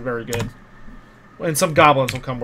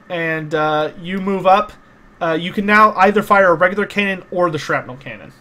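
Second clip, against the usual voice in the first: ordinary talk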